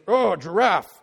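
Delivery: loud, slow-witted caveman naming; a hint of a grunting caveman; dim caveman Adam. Adam naming a giraffe like a caveman. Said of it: "he looks and he's".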